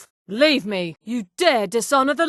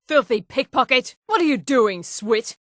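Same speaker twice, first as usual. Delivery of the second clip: ordinary delivery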